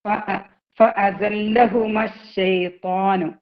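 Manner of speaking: slow, measured teaching recitation; drawn-out model recitation for pupils; Quran recitation. Shown as a demonstration. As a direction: recite slowly with long held sounds. Recites the verse being taught.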